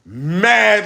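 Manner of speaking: ordinary talk, nothing marked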